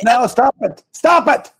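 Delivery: high-pitched, rising protest; flustered plea to stop interrupting; exasperated. Real, not acted. Fends off prompts while thinking.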